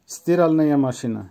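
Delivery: usual speaking voice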